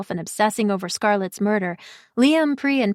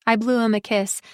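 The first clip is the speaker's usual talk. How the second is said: plain reading voice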